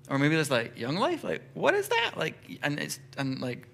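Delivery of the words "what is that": incredulous, far higher pitched question; baffled. Pretend. Friend hearing about Young Life, incredulous question. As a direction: sound totally puzzled by it.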